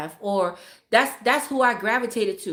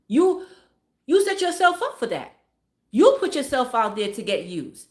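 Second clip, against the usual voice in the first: emphatic preaching; 2 semitones higher than usual. Real, not acted. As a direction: drive it home like a sermon. Preaching point, pitch jump.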